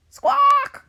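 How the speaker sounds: very high, very loud squawk; parrot squawk. A narrator's reading. Parrot crying out at the crashing wave, shrieked squawk. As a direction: screech it like an alarmed parrot. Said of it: "cries the parrot".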